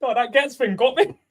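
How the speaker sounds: high-pitched words through laughter; giggling through each word; laughing fit. Real, not acted. Laughing fit over the joke.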